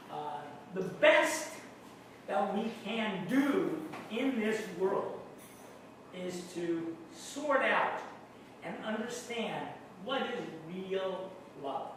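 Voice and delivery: normal talking voice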